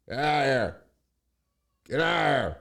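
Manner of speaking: high, loud shout to drive the cat out; real exasperated shout at a noisy pet; yelling at the cat. Real, not acted. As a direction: bellow at the cat to get out.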